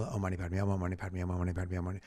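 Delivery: fast, run-on chanting with no gaps; rapid mantra recitation. Shown as a demonstration. Showing how people race through the mantra, rapid recitation. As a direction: run the mantra together quickly.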